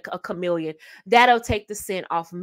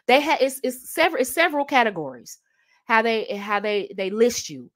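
ordinary talk

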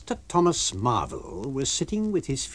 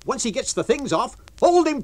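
rising to a loud, high shout; crowd crying out; 12 semitones higher, 6 dB louder than usual. Acted. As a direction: build to yelling hold him. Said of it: "cried everyone".

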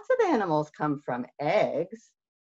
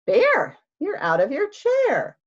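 loud, higher startled call; surprised Hare; 11 semitones higher, 7 dB louder than usual. Acted. Hare finding Bear out of his chair, loud and high. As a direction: sound caught off guard.

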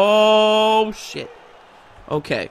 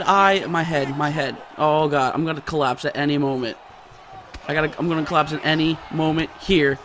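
dazed; 5 semitones lower, 5 dB quieter than usual. Real, not acted. Hurt fighter about to collapse.

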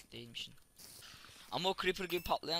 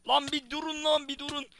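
high, loud shout to stop; high exasperated yell; 11 semitones higher, 8 dB louder than usual. Real, not acted. Begging the attacking mobs to stop. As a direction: yell at them to give him a break.

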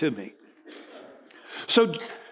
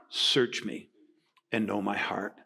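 soft prayer; 6 semitones lower, 6 dB quieter than usual. Acted. Reading the psalm softly.

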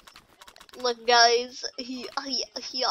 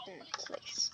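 whisper; 16 dB quieter than usual. Acted.

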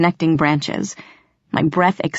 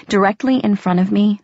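ordinary delivery, nothing marked

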